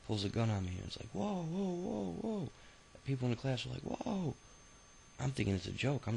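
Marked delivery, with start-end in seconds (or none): 1.1-2.5 high, startled gasping whoas; shocked cry of whoa at a gun. Retold or imitated. Classmate pulls gun, startled reaction. 3.8-4.3 high, shocked single whoa; a stunned gasp from the room; the whole class recoiling. Retold or imitated. Class reacts to the gun.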